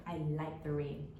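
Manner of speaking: ordinary talk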